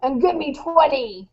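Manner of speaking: mom playing drill sergeant to jolt her son; playful drill sergeant command; army sergeant voice. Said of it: "in my best Army Sergeant voice".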